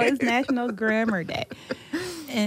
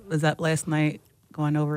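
ordinary delivery

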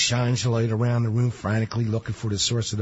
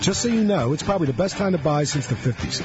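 upbeat, higher ad-read voice; radio ad read; 6 semitones higher than usual. Acted. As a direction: sell it like a radio spot over music. Reads his recorded ad.

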